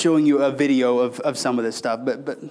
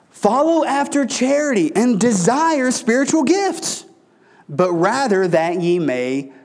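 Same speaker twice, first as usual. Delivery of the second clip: scripture reading; 5 semitones higher, slower than usual. Acted. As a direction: read the verse out slowly like holy writ. Reading scripture aloud in sermon.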